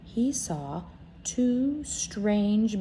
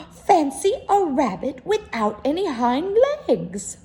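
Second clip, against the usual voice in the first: mocking wild rabbit; 7 semitones higher, 6 dB louder than usual.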